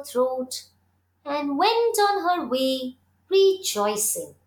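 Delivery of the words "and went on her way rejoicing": ordinary delivery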